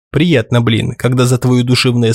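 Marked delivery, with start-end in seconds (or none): none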